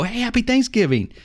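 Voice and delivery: high, chirpy retold hello; cheery greeting. Player walking up to people shooting each other, high cheery greeting. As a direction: break up a fight with a mock-sunny greeting.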